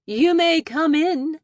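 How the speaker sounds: loud, high, slow mock-posh call; most posh voice. A narrator's reading. Daughter calling to mom behind the door, exaggerated posh voice. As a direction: call out grandly like a put-on aristocrat. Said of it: "in my most posh voice".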